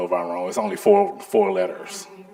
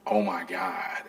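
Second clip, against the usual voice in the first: weary whisper; 8 dB quieter, slower than usual. Retold or imitated. Overwhelmed by the boss's demands.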